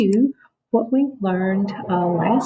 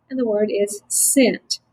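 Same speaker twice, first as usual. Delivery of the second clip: ordinary talk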